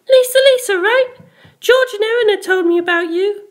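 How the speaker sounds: put-on shy, apologetic girl; Penelope's voice. A narrator's reading.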